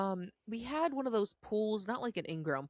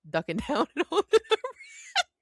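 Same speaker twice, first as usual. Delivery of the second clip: higher, louder, breaking into laughter; laughing; 11 semitones higher, 7 dB louder than usual. Real, not acted. Telling how they hid from the bird, laughing. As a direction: laugh through the words.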